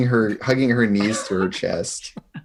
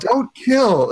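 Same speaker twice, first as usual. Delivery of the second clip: high, slow accusing cry; a child's tantrum, pitched way up; mock tearful yelling; 11 semitones higher than usual. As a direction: wail it as a betrayed accusation. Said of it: "she's just yelling".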